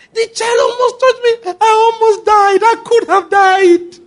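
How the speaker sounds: hammed-up crying panic in a squeaky voice; sobbing scared monster. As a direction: wail like a monster scared to death. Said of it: "Why are you crying?".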